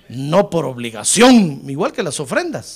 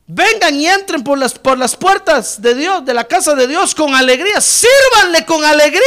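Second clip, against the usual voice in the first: rousing proclamation; 9 semitones higher, 7 dB louder than usual. Real, not acted. Preacher urging church to enter with joy, rousing loud proclamation. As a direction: proclaim it at full force, pitched high.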